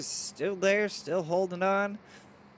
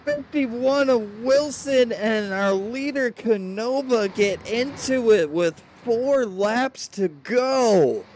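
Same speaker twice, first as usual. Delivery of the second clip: loud, high, slow race call; drawn-out, dismayed commentator shout; excited crash call; 6 semitones higher, 8 dB louder, slower than usual. Real, not acted. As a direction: call the wreck at full voice. Calls crash near race end.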